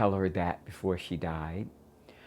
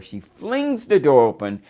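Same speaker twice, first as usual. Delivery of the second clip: ordinary delivery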